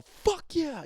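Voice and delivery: excited cheer. Shown as a demonstration. Demo for listeners, high hyped cheer. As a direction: cheer with full hype. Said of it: "Oh, wow, he's really excited".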